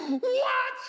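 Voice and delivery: high startled yelp of "What?"; stunned disbelief. Retold or imitated. Hearing the surgeon's offer, a startled high "What?". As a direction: blurt it out in shock.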